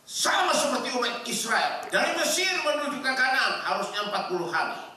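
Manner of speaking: high-pitched pulpit delivery; full-force preaching. Real, not acted. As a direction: preach it out in rising, ringing phrases.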